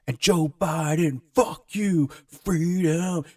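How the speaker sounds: high, slow put-on rock-singer bellow; mocking [name] chorus. Friend mocking [name]'s chorus, high and drawn out. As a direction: ham up the dumb anthem chorus. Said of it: "the chorus is like".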